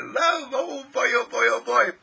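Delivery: high, bubbling delight; overjoyed exclaiming. Acted. Acting out the divine's delight.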